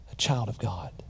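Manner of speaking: tender closing whisper. Acted. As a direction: drop to a whisper on the last item. Closing list of salvation gifts.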